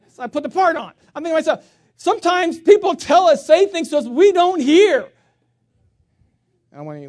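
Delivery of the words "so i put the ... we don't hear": much louder and higher, driving home the point; full-force preaching. Acted. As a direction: hammer the lesson to the crowd.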